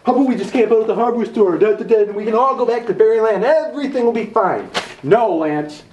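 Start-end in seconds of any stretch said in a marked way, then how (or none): 0.0-4.7 quick, sing-song mock optimism; mocking parroting. Sneering at his partner's camp-out idea, mocking imitation. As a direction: parrot the idea back with scorn.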